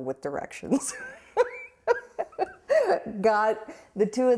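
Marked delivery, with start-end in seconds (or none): 1.9-2.5 a quick high laugh; short laugh. Real, not acted. Laughing about the friend who gets lost. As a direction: laugh at the friend's sense of direction.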